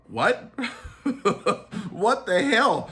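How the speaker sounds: laughing through a disbelieving what; real laugh breaking up the words. Real, not acted. Laughing at his own story of the outraged, real laugh.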